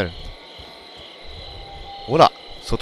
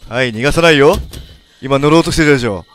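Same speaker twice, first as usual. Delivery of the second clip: louder sing-song threat; gleeful creepy taunt; 3 semitones higher, 7 dB louder than usual. Acted. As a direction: toy with the cornered prey. Cornering victim. (楽しげな不気味な煽り)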